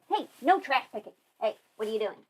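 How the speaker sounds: ordinary talk, nothing marked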